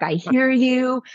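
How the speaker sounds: I hear you, drawn out and lifted high; smug parenting-book voice. Retold or imitated. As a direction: lay on the textbook empathy. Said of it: "I nailed this".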